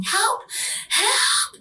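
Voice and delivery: high-pitched re-enacted scream; screaming for help. Patient crying for help, re-enacted scream. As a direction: scream it high, desperate for rescue. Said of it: "Like, really screaming".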